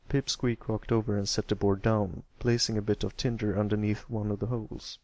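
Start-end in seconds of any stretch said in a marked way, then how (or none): none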